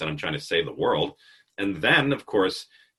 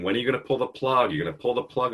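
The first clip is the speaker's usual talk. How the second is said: lightly voiced anxious team, higher and quick; nagging staff; 7 semitones higher, faster than usual. Retelling the meeting, staff pestering the boss, quick and higher. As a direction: mimic the worried staff pressing him.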